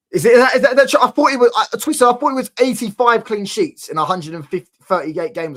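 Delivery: blurting out in disbelief; excited disbelief. Real, not acted. Arguing clean sheet stats, worked up.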